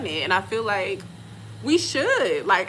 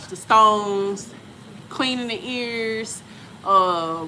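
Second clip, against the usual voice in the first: ordinary talk, nothing marked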